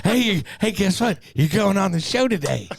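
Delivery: excited puppy voice. Pretend. Voicing the excited dog.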